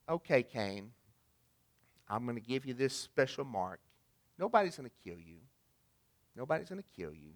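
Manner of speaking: softly voiced God, quiet and reassuring; gentle God. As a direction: let God soothe Cain, softly. God voiced gently sparing Cain.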